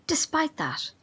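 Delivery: hushed, threatening whisper; menacing whisper. Acted. Carl threatening the reporter, in a menacing whisper. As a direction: lean in and breathe the threat. Cult aide threatens a journalist.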